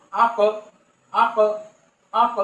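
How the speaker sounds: "আক" with the final consonant bounced into a short echo; "আক" released with a small bouncing puff of sound; qalqalah echo. Shown as a demonstration. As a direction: push off the end of "আক" so it rebounds. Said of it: "হরফগুলোকে ধাক্কা দিয়ে পড়তে হয়".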